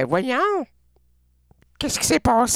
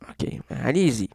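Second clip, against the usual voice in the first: ordinary delivery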